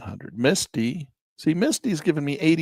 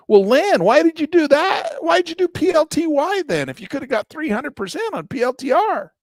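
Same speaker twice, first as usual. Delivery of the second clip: high, loud mock accusing voice; challenging viewer; 7 dB louder than usual. Imagined viewer challenges Lan, high loud mock challenge.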